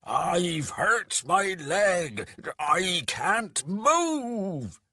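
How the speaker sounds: loud, high, drawn-out harsh wail; wailing dwarf voice. A narrator's reading. Beatrax fooling the guards, wailing in harsh dwarf voice. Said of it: "wailed Beatrax suddenly, in his best harsh dwarf voice".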